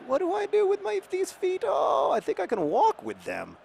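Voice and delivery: put-on cute toddler figuring out walking. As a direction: voice a toddler discovering its feet. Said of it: "That's the cutest when they're like".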